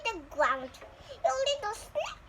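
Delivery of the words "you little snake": high, tender mama voice; loving mama. Acted. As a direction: coo it lovingly like a mother. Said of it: "Mama said lovingly".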